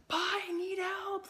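high, drawn-out wail for help; mock cry for help. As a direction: call out in exaggerated, high-pitched distress. Acts out texting for help.

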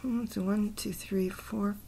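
counting under breath. Real, not acted. Counting a poem's syllables to himself, half-whispered. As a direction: mutter the count to yourself.

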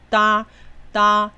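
“哒”高高平平地拉长，念响; 把“哒”提高音调，单独强调; 重音念高. Shown as a demonstration. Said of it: "重要是哒". (高而响亮地示范“哒”)

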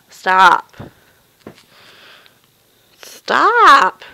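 loud, sharp order to the cat; scolding shout. Real, not acted. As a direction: yell at the cat to quit it.

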